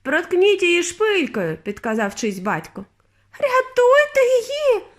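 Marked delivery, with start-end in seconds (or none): 0.0-1.6 high, drawn-out call; called-out suggestion. A narrator's reading. Onlooker calls out advice. 3.4-4.9 very high, drawn-out scream; desperate plea. A narrator's reading. Mother begs for rescue.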